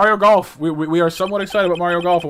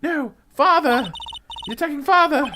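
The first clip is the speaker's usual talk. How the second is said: put-on high-pitched, distressed child's protest; horrified cry; 11 semitones higher, 3 dB louder than usual. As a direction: cry out in acted horror. Acted child sees father attacked.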